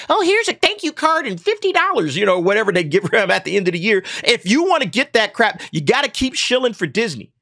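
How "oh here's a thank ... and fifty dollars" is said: high, put-on mock executive voice, much higher; a sugary high-pitched boss handing out a tip; mock Kathleen Kennedy. Voicing Kathleen Kennedy mockingly.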